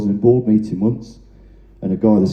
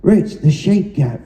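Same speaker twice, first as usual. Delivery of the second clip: retold shout; 6 semitones higher, slower than usual. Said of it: "he said at the top of his voice".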